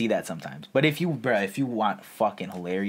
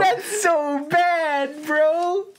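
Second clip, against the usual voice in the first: squeezed out high through laughter, slow and stretched; wheezing the words out mid-laugh; 4 dB louder, much slower than usual. Real, not acted. Mocking a friend's terrible movie pick, slow high laughing whine. Cracking up over movie score.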